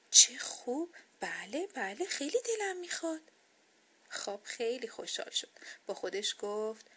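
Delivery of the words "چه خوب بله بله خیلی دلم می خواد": lively, swooping eager yes; eager delight. A narrator's reading. Doll offered sleep for the first time, delighted. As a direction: accept with excited delight.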